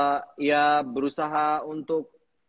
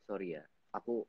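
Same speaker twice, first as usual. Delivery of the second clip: mock sheepish apology; 5 semitones lower, 16 dB quieter than usual. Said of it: "baru dia".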